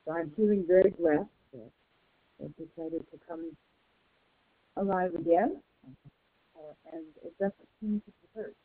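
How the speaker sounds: distant, muffled speakerphone sound; phone speaker. Real, not acted. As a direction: voice coming through a speakerphone. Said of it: "it's a little hard to hear you".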